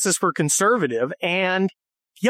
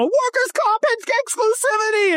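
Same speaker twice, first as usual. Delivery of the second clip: much higher, silly voice mocking his own rant; mocking myself. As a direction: squeak it out like a know-it-all nerd. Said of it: "I'm sitting there, you know".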